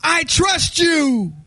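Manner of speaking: high, drawn-out mock shout; desperate man calling up. Dangling man answers the voice above, mock desperate shout. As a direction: call it up the cliff, stretched and eager. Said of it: "The guy says".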